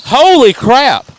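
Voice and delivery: loud, high, drawn-out shock; stunned commentator's shout; astonished outburst. Real, not acted. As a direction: blurt it out in real amazement, stretching the words. Reacting to car going backwards.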